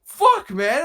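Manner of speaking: high, loud exasperated cry; hammed-up despair about his heart; mock anguish. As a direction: blurt it out in dismay. Retelling feeling awful.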